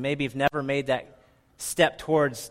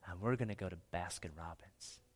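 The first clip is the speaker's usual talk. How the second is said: retold whisper; 5 semitones lower, 8 dB quieter than usual. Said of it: "he knows when I whisper, I mean it".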